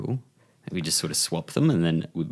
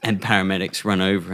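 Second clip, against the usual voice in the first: usual speaking voice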